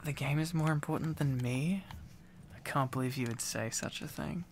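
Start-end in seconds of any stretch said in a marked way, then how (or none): none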